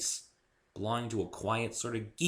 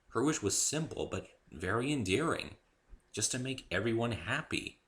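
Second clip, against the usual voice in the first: ordinary delivery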